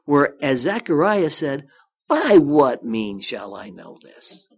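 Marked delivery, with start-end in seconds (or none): 2.1-4.5 lower, slow, doubting and challenging; doubting challenge. Shown as a demonstration. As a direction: ask it as a skeptical challenge. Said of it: "a real doubting, almost challenge".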